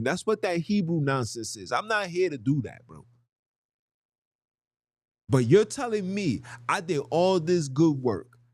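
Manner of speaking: normal talking voice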